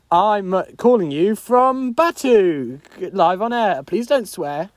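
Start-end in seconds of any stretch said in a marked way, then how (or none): none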